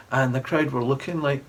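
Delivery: ordinary delivery, nothing marked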